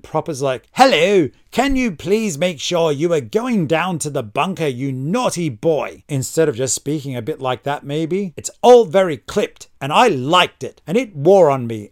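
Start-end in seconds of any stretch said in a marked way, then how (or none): 0.7-6.0 lightly voiced prim British officer accent; a hint of a clipped, proper English accent. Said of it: "totally clipped, too prim and proper". 8.4-9.7 put-on clipped British accent. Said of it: "totally clipped".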